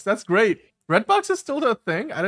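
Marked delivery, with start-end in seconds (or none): 0.9-2.1 high, incredulous question; disbelieving rise on Redbox; surprised. Real, not acted. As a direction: sound genuinely taken aback.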